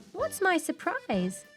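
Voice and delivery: high, drawn-out eager question. Acted. Girl asks Grandma for her surprise, eager. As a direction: ask excitedly, pitched well up.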